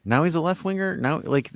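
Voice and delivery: ordinary talk, nothing marked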